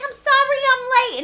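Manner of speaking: high, loud breathless apology; Audrey apologizing. Retold or imitated. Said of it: "She's like".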